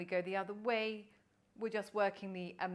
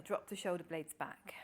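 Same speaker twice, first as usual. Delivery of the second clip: soft unvoiced whisper; whispered cue; 4 semitones lower, 4 dB quieter than usual. Shown as a demonstration. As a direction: breathe the instruction out in a whisper. Cue spoken during breathing.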